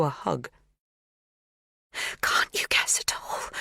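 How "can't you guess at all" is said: whispered, a little breathless. A narrator's reading. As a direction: half-whisper the question, breath catching. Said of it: "a little breathless".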